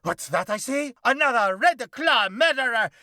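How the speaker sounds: high, loud, mock-heroic vigilante voice; hammed-up cockroach hero. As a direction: declaim like a pompous masked avenger. Cockroach spots new victim.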